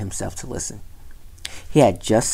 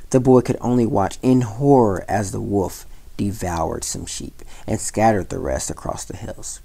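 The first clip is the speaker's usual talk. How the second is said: ordinary delivery, nothing marked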